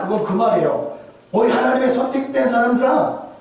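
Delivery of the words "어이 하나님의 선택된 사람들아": high hail to a crowd; hailing call. Acted. Preacher voicing Gamaliel hailing the council, pitched high. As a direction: hail them like chosen people.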